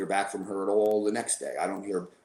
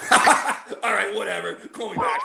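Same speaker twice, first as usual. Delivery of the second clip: breezy mock brush-off, pitched up; dismissive; 9 semitones higher, 4 dB louder, slower than usual. Brushing off the wife's news on the phone, dismissive and pitched up. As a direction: toss it off like a bad joke.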